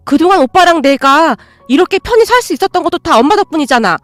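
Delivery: furious reproach in a family fight; angry sister. Acted. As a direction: tear into your brother for betraying mom. Sister scolds brother in quarrel.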